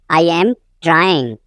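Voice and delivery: teacher's slow split before "trying"; slow repeat. Shown as a demonstration. Teacher repeats sentence slowly.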